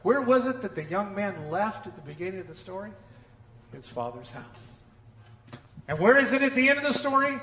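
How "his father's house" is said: soft answer. Acted. Quiet answer to question.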